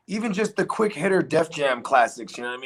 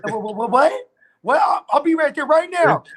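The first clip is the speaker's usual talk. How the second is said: high, loud stammering phone reaction; stammer, then blurt it out high and loud; hammed-up alarm on a phone call; mock urgent; 11 semitones higher, 5 dB louder than usual.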